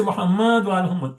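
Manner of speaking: "Muhammad" without in, run on into "wa"; wrong joining. Retold or imitated. Teacher quoting what they say wrongly, bare "Muhammad" joined on. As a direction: leave off the ending of "Muhammad" without pausing. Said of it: "It's wrong grammatically".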